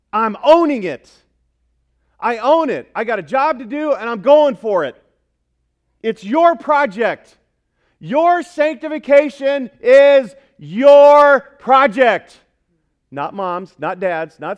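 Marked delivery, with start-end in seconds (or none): 0.1-7.4 loud, raised, fired-up declaration; forceful preaching. Real, not acted. Preacher urging congregation to own their growth, forceful. 8.0-12.4 "Your" loud, high and drawn out, both times; slow, booming declaration leaning hard on "your"; emphatic YOUR. Real, not acted. Preacher handing the congregation responsibility, "your" hugely emphatic. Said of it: "That's why I made it emphatic".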